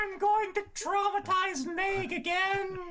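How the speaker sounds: very high falsetto cartoon voice; Mickey. Game master teasing the players, high cartoon voice. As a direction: squeak it out slowly in falsetto. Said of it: "with the Mickey voice".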